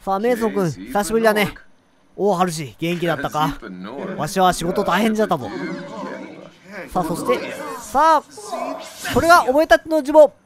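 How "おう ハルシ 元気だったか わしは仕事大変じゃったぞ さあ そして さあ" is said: low, lightly voiced old-timer; creaky wise old man. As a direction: speak as a creaky old sage. Voicing an old ghost in-game. (しわがれた老賢者の声)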